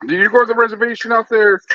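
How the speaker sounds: high, eager question; curious people. Retold or imitated. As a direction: ask like a nosy stranger. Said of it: "people are like".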